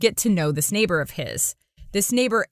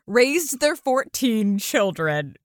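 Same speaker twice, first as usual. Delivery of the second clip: ordinary talk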